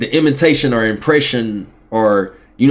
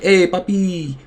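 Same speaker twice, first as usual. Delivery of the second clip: higher-pitched, spiced-up Spanish accent; 6 semitones higher, slower than usual. Shown as a demonstration. Showing friends how he'd sound speaking Spanish, Spanish accent. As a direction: put on a playful Spanish accent, drawing out "mamacita". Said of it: "if I wanted to sound like I was speaking Spanish".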